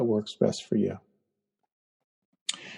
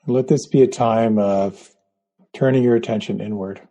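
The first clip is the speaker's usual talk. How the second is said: guided meditation. Acted. Leads a guided breathing meditation.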